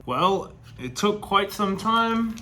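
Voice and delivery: talking through a face mask; muffled by mask. Real, not acted. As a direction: speak muffled behind a mask. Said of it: "take these fucking things off".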